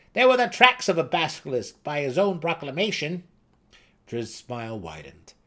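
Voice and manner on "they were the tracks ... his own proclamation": high, loud scoffing gnome; mocking gnome. A narrator's reading. Seldig scoffs at the boy's uncle story, high and mocking.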